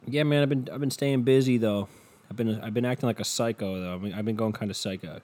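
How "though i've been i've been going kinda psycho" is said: normal talking voice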